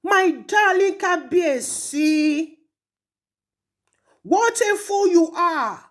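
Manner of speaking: ordinary talk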